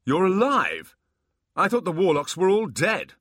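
loud, high astonished voice; stunned joy at the news; amazed. Acted. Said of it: "obviously amazed".

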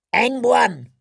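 high crone bid; old crone bidding. A narrator's reading. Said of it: "said the crone in the violet toga".

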